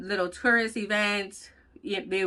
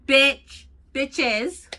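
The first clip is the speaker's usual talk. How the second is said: louder, higher insult; defiant shout; loud call-out; 4 semitones higher, 6 dB louder than usual. Real, not acted. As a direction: throw it at the haters. Calling out critics.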